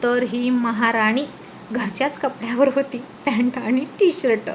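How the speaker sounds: plain reading voice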